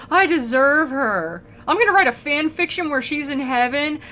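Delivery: high-pitched, defensive lovesick pleading; hammed-up self-pitying insistence; whiny "nice guy". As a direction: protest like a spurned, deluded admirer. Spurned "nice guy" Dante, acted.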